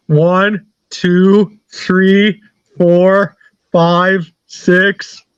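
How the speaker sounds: one drawn-out number at a time; slow stalling count. Retold or imitated. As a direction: count very slowly to waste the caller's time.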